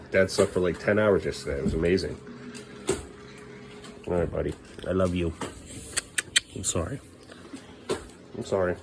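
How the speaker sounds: ordinary talk, nothing marked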